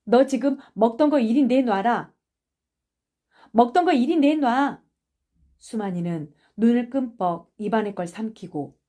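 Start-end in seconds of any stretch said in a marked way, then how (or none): 0.0-4.8 stern, louder command, rising on the repeat; firm order, pressed harder the second time. A narrator's reading. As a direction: demand it be handed over. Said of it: "기수는 엄한 얼굴로".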